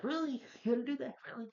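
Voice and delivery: high, whiny disbelief; incredulous. Retold or imitated. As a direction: squeak out betrayed disbelief. Flash quoted after leg broken.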